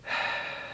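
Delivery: soft, breathed-out sigh; weary sigh. Acted. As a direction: sigh quietly before going on. Weary pause before the nightmares.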